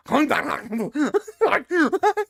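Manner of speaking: high guttural gibberish barking; mock German. Cohost mocking German as a sexy accent, guttural nonsense. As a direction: imitate angry German with choppy throat noises. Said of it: "chose German as a sexy accent".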